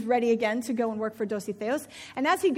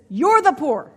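louder, blunt comeback; sharp retort; 3 semitones higher, 10 dB louder, slower than usual. Acted. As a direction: throw it right back at him. Wife retorts to husband.

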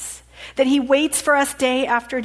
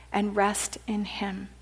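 whisper; 3 semitones lower, 8 dB quieter than usual. Acted. Sermon, invitation to rest.

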